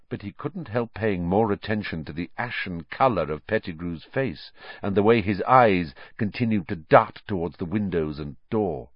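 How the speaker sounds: plain reading voice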